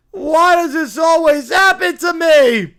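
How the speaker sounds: loud, melodramatic lament; despairing yell. Acted. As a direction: wail it as the eternal victim. Acted despair in script reading.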